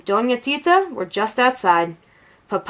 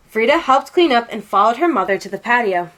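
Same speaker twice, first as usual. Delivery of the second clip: ordinary delivery, nothing marked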